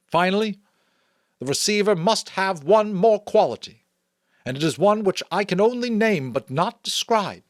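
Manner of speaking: solemn elder's public proclamation; chief elder's address. Acted. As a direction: speak up to the whole community with ceremony. Chief elder continues speech.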